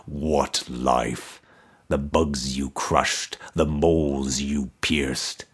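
low, soft, toneless voice of a buried skull; resonant toneless skull. A narrator's reading. Buried emperor's skull answering Mordecai, low resonant toneless voice. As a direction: intone it without any tune, deep in the earth. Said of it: "answered in a resonant voice, toneless".

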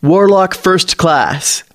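put-on young officer naming his rank, proud and slow. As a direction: announce Warlock like a title you love. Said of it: "Navy announces, lifting his chin proudly".

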